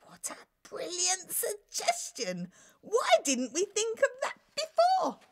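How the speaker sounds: high, laughing delight. Acted. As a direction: cry out with joyful relief. King delighted by jester's idea.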